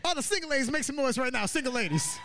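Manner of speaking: high-pitched hype shout to the audience; crowd call-out. Real, not acted. Comedian falling back on a sure crowd-pleaser, high hype call.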